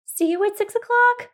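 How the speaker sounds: high, loud rabbit call. Acted. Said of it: "called the rabbit".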